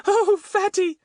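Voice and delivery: very high, admiring little voice; excited Betts, delighted. A narrator's reading. Betts to Fatty about his story, delighted high voice.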